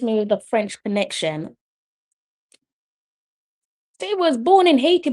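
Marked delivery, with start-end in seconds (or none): 4.0-5.1 excited reveal. Real, not acted. Reporting a surprising fact.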